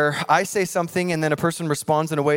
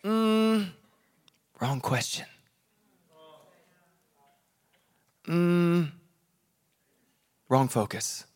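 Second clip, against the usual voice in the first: God correcting; 5 dB quieter, much slower than usual. Retold or imitated. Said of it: "he's going".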